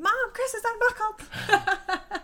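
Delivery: high, drawn-out tattling yell; mock childhood scream. Kid in the minivan tattling on an unbuckled brother, mock scream. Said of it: "and then scream".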